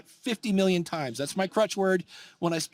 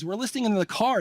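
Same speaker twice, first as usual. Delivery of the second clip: ordinary talk, nothing marked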